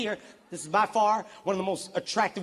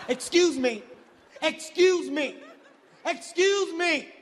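repeated demand climbing steadily in pitch; indignant insistence; 8 semitones higher, much slower than usual. Acted. Snubbed man demanding woman's attention, mounting indignation. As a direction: build from raised voice to high, drawn-out outrage.

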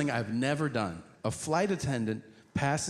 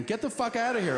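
higher, exasperated dismissal; irritated brush-off; 8 semitones higher, faster than usual. Retold or imitated. Stranger in the toilet shooing the comic, exasperated. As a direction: shoo him off, fed up.

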